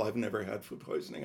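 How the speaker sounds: usual speaking voice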